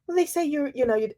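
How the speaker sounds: ordinary talk, nothing marked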